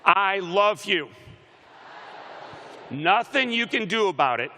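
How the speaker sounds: loud, high declaration of love; rallying shout. Shown as a demonstration. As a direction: belt it out for the crowd to repeat. Leading crowd in declaring love.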